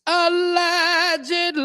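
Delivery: drawn-out high sing-song allegedly; high, slow, knowing allegedly; mock ominous allegedly. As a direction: say it like a wink to the audience. Sarcastic aside with music sting.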